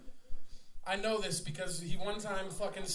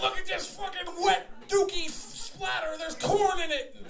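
a lightly raised, grossed-out show-and-tell voice; mock disgusted narrator; 7 semitones higher than usual. Joking about posting beer shits online, mock disgusted. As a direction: play up the disgust with a laugh.

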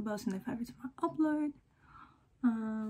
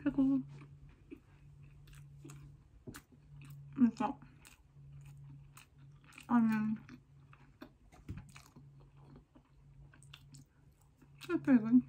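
chewing while talking; talking between bites; mouth full; 4 dB quieter than usual. Real, not acted. Seen 0: Cheeks bulge and jaw chews between bites while she talks.

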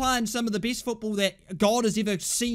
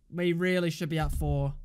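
ordinary delivery

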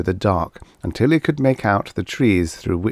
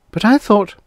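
high, louder objection, cut off; protesting; 13 semitones higher, 6 dB louder than usual. A narrator's reading. Girl objects to Guthrie's plan, protesting. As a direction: start to object in a raised girl's voice.

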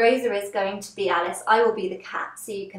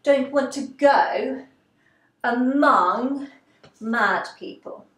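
higher, drawn-out character line; Alice; 3 semitones higher, 3 dB louder, much slower than usual. Acted. Teacher voicing Alice for the mime class, theatrical and drawn out. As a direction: stretch each phrase slowly and theatrically. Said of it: "And then Alice says".

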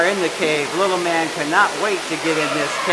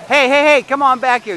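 calling out; 9 semitones higher, 8 dB louder than usual. Real, not acted. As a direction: shout him back before he goes too far. Calling child back from creek.

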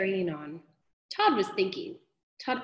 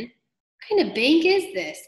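slow, higher, louder puzzled question; incredulous; 7 semitones higher, 5 dB louder, slower than usual. A narrator's reading. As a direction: draw out the robber's disbelief. Robber baffled by the loot.

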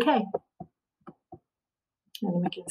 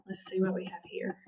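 whisper; 16 dB quieter than usual. Real, not acted. Teacher mutters while checking.

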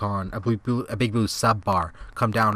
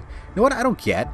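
1 excited question; 12 semitones higher, 3 dB louder than usual. Real, not acted. Launching a rant.

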